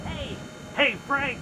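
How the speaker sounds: high, loud calls to a friend far off; calling out. Acted. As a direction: yell for Frank to come over. Calling to comrade across distance.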